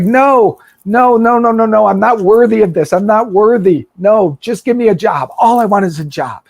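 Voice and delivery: high, loud, protesting no; a son refusing his father's welcome, pleading high and loud. Retold or imitated. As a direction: beg him to stop, unworthy. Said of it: "He's like".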